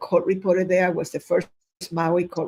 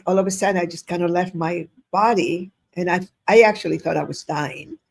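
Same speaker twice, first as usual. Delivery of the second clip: normal talking voice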